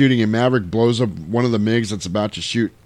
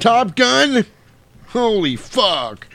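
mock disbelief; 11 semitones higher, much slower than usual. Commander stunned.

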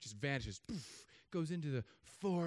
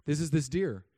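ordinary talk, nothing marked

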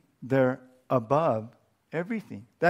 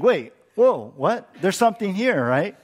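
surprised; 5 semitones higher, 5 dB louder than usual. Retold or imitated. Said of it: "Which you would think".

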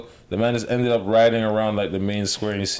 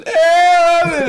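loud, high drawn-out holler; imitated window yell; 10 dB louder than usual. Man imitating quarantined residents calling from their windows, yelling. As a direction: call out far across the buildings. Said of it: "people in their buildings yelling from their windows".

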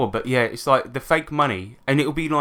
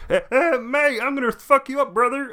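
much higher, hammed-up British tough guy; same threat, now in a bad British accent; mock British accent; 13 semitones higher than usual. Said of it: "British accent".

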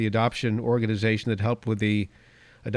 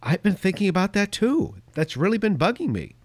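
wife's voice; 7 semitones higher than usual. Retold or imitated. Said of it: "And she said".

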